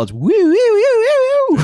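cartoon whistle sound. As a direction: imitate a silly cartoon whistle. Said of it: "every time Gus kicks the ball".

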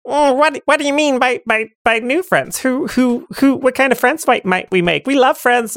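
high, eager creature voice; curious kidney. Acted. Right kidney asking about new friends, high and eager. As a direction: stammer out eager questions, pitched high. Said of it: "Right Kitty goes".

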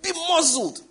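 high, sharp order; irritated rebuke. Retold or imitated. Jesus to the wind, irritated rebuke. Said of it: "the irritation and annoyance in him".

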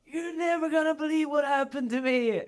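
excited imitation. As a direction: mimic a friend blurting out big news. Friend imitates him arriving.

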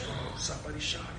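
ordinary talk

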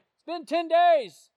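high, loud, drawn-out holler; yelled announcement. Retold or imitated. Man proudly telling the officer he is clean, hollering. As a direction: shout it slowly across the distance. Man yells his news across road.

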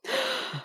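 sharp breathless gasp of fear; frightened gasp. Acted. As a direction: gasp in terror. Monster arrives, fear.

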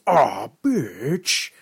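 high, drawn-out creature voice; Scary Terry voice. Retold or imitated. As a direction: stretch out hey it's you guys. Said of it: "love Scary Terry with his".

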